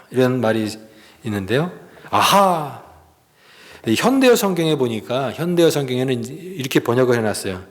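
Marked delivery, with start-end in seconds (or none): 2.1-2.8 lightly imitated scornful "aha"; mock like a jeering passerby. Preacher imitates passersby insulting Jesus, mildly mocking. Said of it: "예수를 모욕하여".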